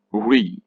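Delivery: re as a long, clear REE; stressed syllable. Shown as a demonstration. Teacher contrasting stressed forms, re loud and clear. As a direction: give re a full drawn-out ee. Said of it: "That is loud and clear".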